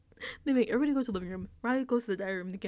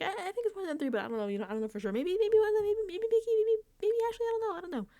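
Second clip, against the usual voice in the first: high-pitched, stammering mock indecision; dithering Vince; 11 semitones higher, faster than usual. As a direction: climb into a squeaky run of hedging guesses. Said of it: "He's like".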